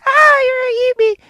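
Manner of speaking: high, loud pretend scare; mock frightened cry. Retelling the girl scared by an animal, mock frightened cry.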